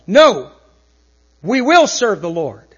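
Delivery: firm declaration. Acted. Quoting the people's firm answer.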